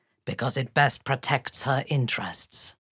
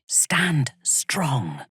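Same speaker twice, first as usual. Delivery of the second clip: whisper. Acted. Warrior whispers fierce encouragement to an ally.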